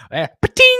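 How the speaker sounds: mock ping of spit hitting a metal pail, sharply high and loud; a playful spittoon sound effect. Joking with chat about a Kansas spit pail, sharp pa-ting noise.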